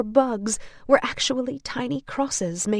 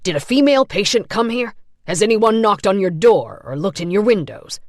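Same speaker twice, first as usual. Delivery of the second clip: louder, fast barrage; fast demanding; 8 dB louder than usual. A narrator's reading. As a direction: interrogate rapidly. Orderly interrogating Lawrence.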